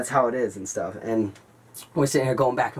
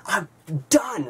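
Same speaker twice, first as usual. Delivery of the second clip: retold scream of I'm done, pitched way up; 9 semitones higher than usual. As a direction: yell it out at the top of your lungs. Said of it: "started screaming on top of my lungs".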